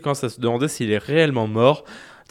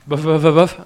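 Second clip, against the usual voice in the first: playful, louder bored bof; teasing mock shrug; 4 dB louder than usual. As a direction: tease her by dragging out the shrug. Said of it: "abuse pas".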